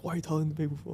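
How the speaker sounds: lightly voiced dad asking why you'd tell people; imitating dad. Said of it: "he's like".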